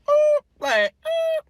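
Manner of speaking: very high loud coy oh; mock flustered oh. Reacting to the boy hitting on her, high coy oh. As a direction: play it startled and flattered.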